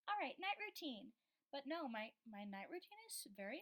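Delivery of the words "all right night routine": high, louder mock YouTuber intro; put-on vlogger voice, pitched up. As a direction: announce it like a perky YouTuber. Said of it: "I sound like a YouTuber".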